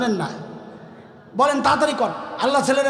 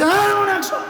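put-on high pitched sulky child complaining; a boy sulking over only a hundred taka; whining child; 9 semitones higher, slower than usual. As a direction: whine like a boy who got too little. Sulking boy voiced in story.

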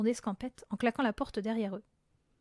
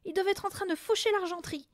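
high-pitched fretting; agitated urgency; 9 semitones higher than usual. A narrator's reading. Mother fearing for the silverware, agitated. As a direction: urge them on anxiously. Mother frantic about burglars.

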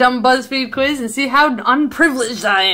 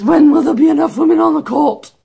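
higher-pitched put-on old lady voice; mock old lady; 3 semitones higher, 4 dB louder than usual. Speaker reading the old lady's picture caption, mock old-lady voice. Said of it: "an old lady here that says".

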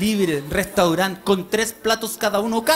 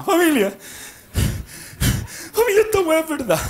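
father shouting to family; 9 semitones higher than usual. Retold or imitated. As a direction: whip the family up like a crazed dad. Father rallying family at hotel.

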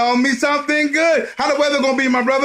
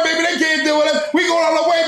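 ordinary talk, nothing marked

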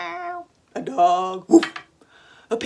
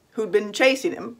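plain reading voice

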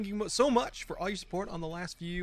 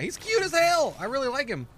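gushing; 9 semitones higher, 7 dB louder than usual. Real, not acted. Cooing over his dino.

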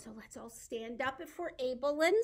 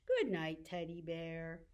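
lower, softer, winding down; soft goodnight; 6 semitones lower, 7 dB quieter than usual. Pretend. Storyteller puts teddy to bed, soft goodnight. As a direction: hush it like bedtime.

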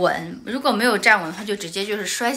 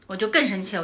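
normal talking voice

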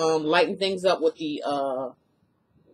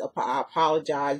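normal talking voice

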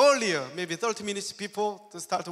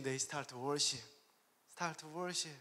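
normal talking voice

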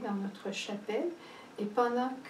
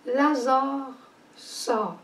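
a louder, slow commanding call; cry out; 3 semitones higher, 5 dB louder, much slower than usual. Retold or imitated. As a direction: call Lazarus out with authority. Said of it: "Jésus cria".

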